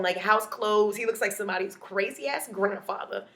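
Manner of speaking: ordinary delivery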